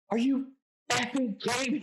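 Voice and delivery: high, loud mock outburst; flipping out. Girl at the party stands up after the last score, flipping out. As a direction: imitate her jumping up and losing it. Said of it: "Like, flips out".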